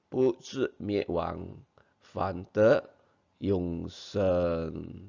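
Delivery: slow spoken verse, phrase by phrase; slow recitation. Shown as a demonstration. Teacher models the Mandarin verse for children, reciting slowly. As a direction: recite slowly with a pause after each phrase.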